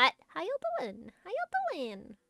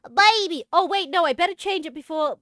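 ordinary talk

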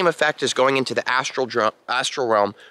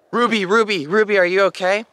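higher, louder calling of the name; urgent calling out; 7 semitones higher, 4 dB louder than usual. Retold or imitated. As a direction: call her name to wake her, alarmed. Shaking Ruby awake, calling her.

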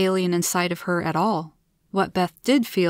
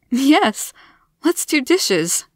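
higher, amazed agreement; wonder; 7 semitones higher, 4 dB louder, much slower than usual. A narrator's reading. Beth, suddenly free of fatigue, agrees with wonder. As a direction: let wonder lift the voice, louder on the yes. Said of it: "spoke with wonder in her voice".

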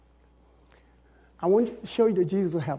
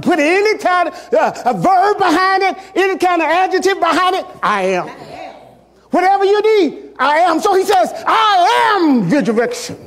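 shouted pulpit climax; full-force preaching; 9 semitones higher, 9 dB louder than usual. Acted. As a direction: thunder the names of God.

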